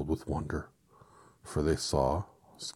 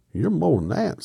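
high, loud, aggrieved protest; grieved tone; 13 semitones higher, 10 dB louder than usual. A narrator's reading. Scarecrow reproaching the false wizard, loud grieved tone. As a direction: start high and wounded. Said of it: "said the Scarecrow in a grieved tone".

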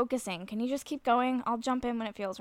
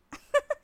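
burst of laughter; 7 dB louder than usual. Real, not acted. Laughs at friend getting naked.

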